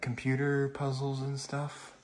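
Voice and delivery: normal talking voice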